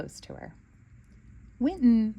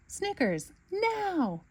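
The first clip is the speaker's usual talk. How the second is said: shout; 11 semitones higher, much slower than usual. Acted. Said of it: "Bluey shouts to Snickers".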